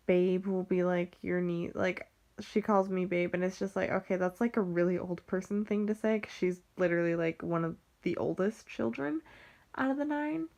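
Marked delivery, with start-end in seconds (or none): none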